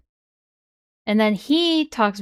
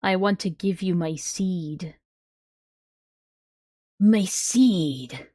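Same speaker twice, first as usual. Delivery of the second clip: dark whisper behind you; 2 semitones lower, slower than usual. Acted. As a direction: read it like a lurking creep. Reading creepy chat in voice.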